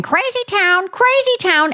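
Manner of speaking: high-pitched, held-out mimicry; mock singsong. Host mimics rival riding off to her room, mock singsong. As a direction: chant it high, mocking the rival.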